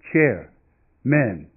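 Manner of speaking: plain reading voice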